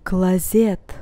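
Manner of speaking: "Клозет" with the O said as A; unstressed O as A. Shown as a demonstration. Teacher shows learners an unstressed O, "Клозет" said with an A. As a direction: say "Клозет" as kla-ZET. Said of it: "I pronounce it as A".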